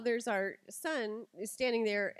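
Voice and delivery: ordinary talk, nothing marked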